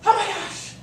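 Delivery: high, loud hammed-up panic; dramatic overreaction. Shown as a demonstration. Showing the class a stressed overreaction, hammed-up and loud. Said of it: "act with lots of drama and exaggeration".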